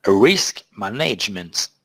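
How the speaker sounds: slow, hammed-up English accent. Trader showing off his English accent to viewers, mock-proud. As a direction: savour each word like a proud Brit. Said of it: "Appréciez mon accent anglais magnifique".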